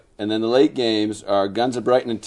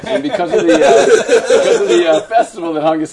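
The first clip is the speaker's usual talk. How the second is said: laughing; 6 semitones higher, 7 dB louder than usual. Real, not acted. Laughs mid-announcement.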